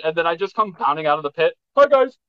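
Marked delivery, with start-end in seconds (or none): 1.8-2.2 high, sing-out mock greeting; playfully acted high-voiced hello; chirpy reassuring greeting. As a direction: pop up with a high-pitched hello. Acts out surprise return from pit.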